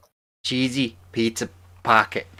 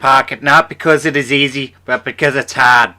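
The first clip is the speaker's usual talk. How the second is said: Kennedy impression; 3 semitones higher, 7 dB louder than usual. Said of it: "now you sound like a Kennedy".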